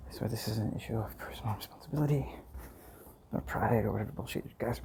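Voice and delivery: breathy brooding whisper. Acted. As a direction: hush it like a dark secret.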